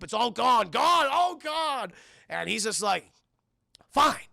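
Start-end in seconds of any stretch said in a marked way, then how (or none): 0.0-1.9 high, loud, drawn-out lament; despairing dad. Retold or imitated. Dad rants over lost turkey. 3.9-4.3 exasperated dad. Retold or imitated. Dad gives in after the rant.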